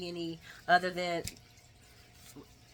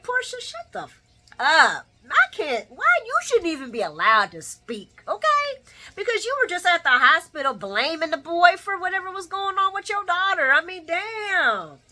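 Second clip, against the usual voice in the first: much higher and louder, ranting in outrage; furious rant; 10 semitones higher, 8 dB louder than usual. Retold or imitated. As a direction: tell the character off, full fury. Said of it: "And I'm like".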